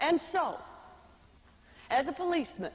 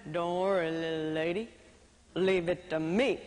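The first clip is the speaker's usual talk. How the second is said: John Wayne; 9 semitones lower than usual. Retold or imitated. Policeman reassuring the singer, in a low cowboy voice. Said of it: "looking just like John Wayne".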